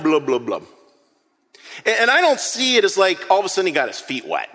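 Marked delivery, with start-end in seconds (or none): 0.0-0.7 1 mock drowning gurgle. Peter sinking under water.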